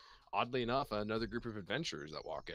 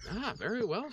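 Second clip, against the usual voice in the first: barmaid; 12 semitones higher, 4 dB louder than usual. Acted. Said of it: "she says".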